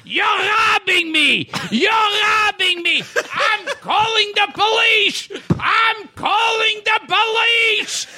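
put-on high, loud shouting old man; frantic old boss shouting. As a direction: yell it like a panicked shop owner.

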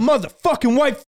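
high, furious repeat of the slur; mock yell of an angry stranger; freaking out. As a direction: yell it like he's losing it. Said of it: "freaking out".

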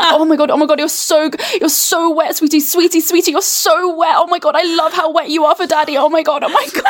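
high-pitched, breathless mock gushing; overexcited babble, pitched well up; gushing imitation. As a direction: imitate someone rapidly overcome with excitement. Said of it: "it's like".

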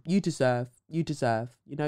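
ordinary delivery

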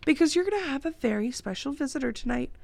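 hint playfully at a surprise; hint of mischief. A narrator's reading. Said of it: "a hint of mischief in her voice".

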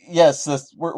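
ordinary talk, nothing marked

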